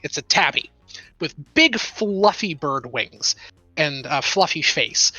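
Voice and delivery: ordinary delivery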